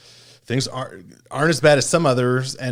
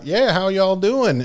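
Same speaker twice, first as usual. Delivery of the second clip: slow, high Texas drawl on a friendly greeting; Texas accent; 7 semitones higher, slower than usual. Retold or imitated. Imitating Texas doctor greeting patient in Texas accent. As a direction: put on a Texan doctor's folksy Texas accent. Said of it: "Kinda had the Texas accent".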